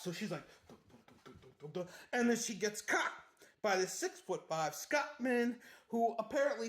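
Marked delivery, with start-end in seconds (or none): 0.7-1.9 very soft, low tiptoe sound; hushed little staccato imitation of creeping; sneaking "dum dum dum". Sylvie sneaking out of the house, hushed sound effect.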